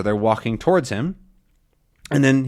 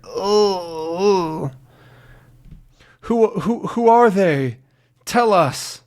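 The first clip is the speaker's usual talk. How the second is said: urgent pleading; 7 semitones higher, much slower than usual. Acted. Urgent questioning in story.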